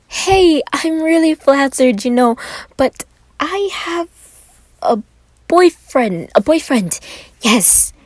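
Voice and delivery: high, flustered stammering plea; nervous stutter. A narrator's reading. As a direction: stammer it out, rattled and trying to deflect. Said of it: "Roman stuttered out".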